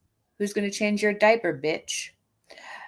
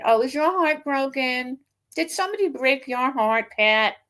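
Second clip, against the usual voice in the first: higher, syrupy fake pity; mock sympathy; 6 semitones higher than usual. Real, not acted. Mocking the rival's broken-heart post with fake pity. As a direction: coo it with fake concern.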